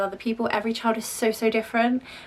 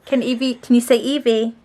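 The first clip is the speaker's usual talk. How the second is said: louder coaxing voice; 2 semitones higher, 8 dB louder than usual. Real, not acted. Mum asking her toddler to copy words, coaxing. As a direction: prompt a little one to copy you.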